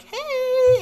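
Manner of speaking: sharp high yelp of surprise; startled yell. Retold or imitated. Girl caught in the act yells at the intruder, startled high yelp. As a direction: squeal it like she's been caught.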